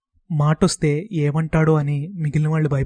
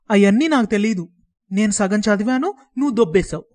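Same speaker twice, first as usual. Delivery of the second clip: put-on fuming boy, higher and louder; 6 semitones higher, 3 dB louder than usual. A narrator's reading. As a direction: seethe as he accuses. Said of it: "ఉడికిపోతూ". (మండిపడుతూ పిల్లాడి మాటలు)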